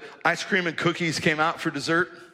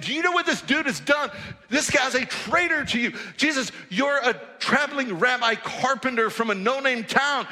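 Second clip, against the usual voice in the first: high, raised, exasperated objection; protesting crowd; 10 semitones higher than usual. Pretend. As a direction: plead with Jesus in disbelief. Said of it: "Now you just imagine".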